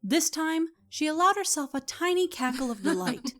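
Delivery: usual speaking voice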